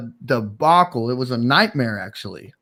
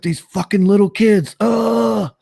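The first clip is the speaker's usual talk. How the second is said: mock exasperated outburst, higher and louder; angry friend, imitated; 8 semitones higher, 4 dB louder, slower than usual. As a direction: imitate him blowing up, ending on a high groan. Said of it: "get real angry".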